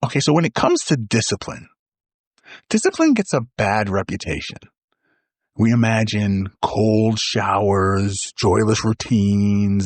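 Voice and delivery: ordinary delivery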